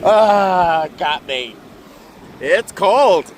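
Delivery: loud high yelp, then laughing off; shocked by cold water. Real, not acted. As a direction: gasp at icy water. Cold wave hits feet.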